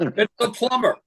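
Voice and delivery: high-pitched, mildly exasperated plumber; growing agitation. Acted. Plumber repeating himself to a parrot, mounting agitation. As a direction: act a plumber starting to lose patience. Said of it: "Guy's getting increasingly agitated".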